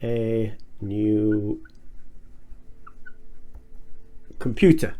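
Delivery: slow, word by word, long pauses; slow dictation. Shown as a demonstration. Teacher reads example sentence, slow and word by word. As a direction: spell each word out for the class. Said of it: "if I write the sentence".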